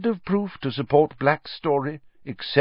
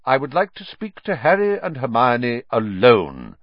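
raised pitch, abrupt firm request; 4 semitones higher, 4 dB louder than usual. A narrator's reading. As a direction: break in abruptly and firmly. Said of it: "said Dumbledore abruptly".

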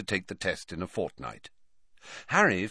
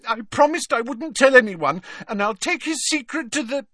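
very high, melodramatic vow; high-pitched, impassioned protest; indignant ghost girl; 5 dB louder than usual. Acted. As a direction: declare it loudly in a high girlish wail. Ghost girl vows dramatically.

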